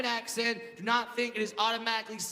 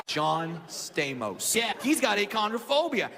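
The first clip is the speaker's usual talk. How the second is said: ordinary talk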